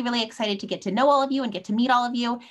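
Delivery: usual speaking voice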